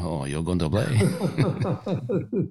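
mock the French caddie in a stretched French accent. Host imitating incredulous French caddies, slow French accent. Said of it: "the caddies in their French accent".

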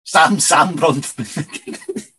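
Sam Bronfman said slowly, each syllable drawn out; slow careful name. Shown as a demonstration. Confirming the name for the co-host, slow and careful. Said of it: "And it's Sam".

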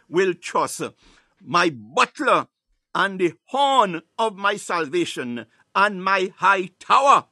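slow, ringing proclamation building to the last word; loud, with conviction. Shown as a demonstration. Preacher urging listeners to personalize the psalm, loud and convinced. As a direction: declare it loudly and confidently. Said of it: "say it with conviction and say it loud".

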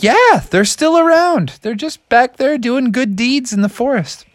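high, chipper frog voice; eager frog. Acted. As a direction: answer brightly as the little frog. Froggy answers parents.

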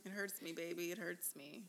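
soft, sinking mock sob of It hurts me; mock crying. Host mimicking the wife who started crying at dinner. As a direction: imitate the wife's tearful whimper, lightly. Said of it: "And then start crying".

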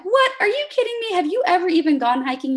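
high, loud, squealing disbelief; can't believe what a friend just said; shocked disbelief. Retold or imitated. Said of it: "And I'm like".

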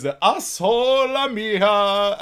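slow, loud squeezed voice; muffled butt voice. Retold or imitated. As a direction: drag it out like a talking butt. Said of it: "He goes".

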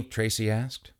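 ordinary delivery

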